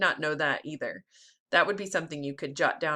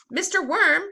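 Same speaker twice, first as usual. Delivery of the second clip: calling out; 9 semitones higher, 7 dB louder than usual. Acted. Child character calls to worm.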